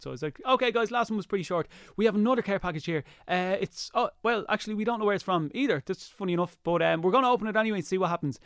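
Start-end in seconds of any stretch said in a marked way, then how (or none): 0.4-7.5 higher-pitched presenter patter; video host. Retold or imitated. Re-enacting his episode intro for the host, lightly put-on presenter voice. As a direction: retell yourself addressing the viewers, pitched up.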